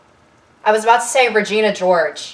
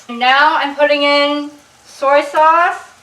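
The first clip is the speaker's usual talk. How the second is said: slow, higher, talking round a chopstick; mouth full; 5 semitones higher, slower than usual. Real, not acted. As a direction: speak with a chopstick held in the mouth. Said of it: "I have chopstick in my mouth".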